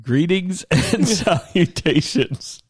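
announcer intro. Acted. Podcast intro.

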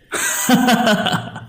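high cackling ghostly laugh; savage ghost laugh. Acted. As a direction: let out a wild, cruel laugh. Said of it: "cười lên đầy man dợ".

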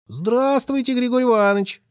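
put-on high, sugary child's greeting; a sly boy sucking up in a sugary piping voice; sweet thin little voice. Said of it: "сладеньким тонким голоском".

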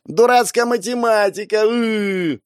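high frustrated yell and groan; frustrated kid shout. Acted. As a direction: shout it at the homework. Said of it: "из комнаты послышалось".

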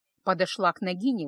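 ordinary delivery, nothing marked

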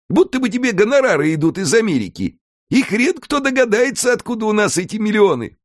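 a scheming house spirit pitching the plan; Alfred the house spirit. A narrator's reading. Alfred to Mika, laying out the island scheme, in a high lively voice.

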